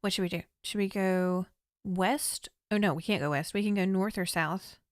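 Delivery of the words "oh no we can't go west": ordinary delivery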